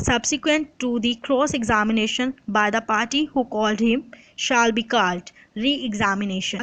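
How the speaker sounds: normal talking voice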